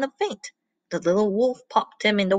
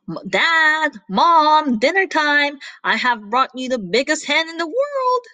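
shouting; 6 semitones higher than usual. Acted. Little wolf calls his parents to dinner, shouting. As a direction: call out excitedly, words stretched. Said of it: "he shouted".

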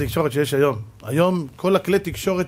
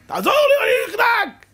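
very high, loud cry for help; hammed-up desperate shriek; acted choking panic; 4 dB louder, slower than usual. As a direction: yell as if choking. Acting a child choking on pen.

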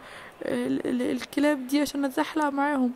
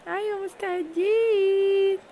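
higher, very slow, drawn-out yes we're ready; eager answer; 7 semitones higher, much slower than usual. Acted. Kids shouting back to the trainer that they're ready. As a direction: stretch it out like an excited kid.

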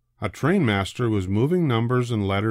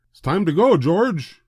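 high, loud friendly call; cheerful call; 8 semitones higher, 5 dB louder than usual. A narrator's reading. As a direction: call the monkey over brightly. Man calls George cheerfully.

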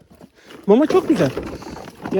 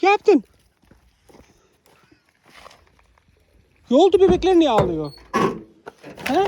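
real raised-voice pet talk; concerned questioning; 7 semitones higher than usual. Real, not acted. Questions dog about crying puppies.

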